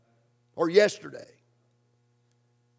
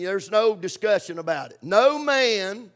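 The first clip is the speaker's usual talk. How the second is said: ordinary talk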